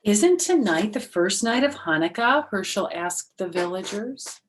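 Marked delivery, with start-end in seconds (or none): 0.0-2.5 surprised question. Acted. Herschel asks villagers.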